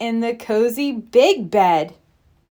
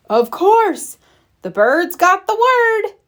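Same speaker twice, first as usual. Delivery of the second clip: excited yell; 6 semitones higher than usual. Acted. Ted yelling to Fred that the bird is right, excited shout. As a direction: shout it out, delighted the problem is solved. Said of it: "yelled Ted".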